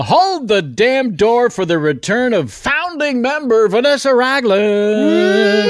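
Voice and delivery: booming showman announcement, slowing on the name; announcer intro. Acted. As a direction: call her in like a game show host. Grand intro of returning member.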